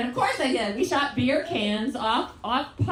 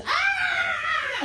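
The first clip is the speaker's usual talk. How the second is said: high-pitched imitated child's scream; blood-curdling scream. Mother imitating a scream from across the property, blood-curdling scream. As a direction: let out a sudden high mock scream. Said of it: "blood-curdling scream".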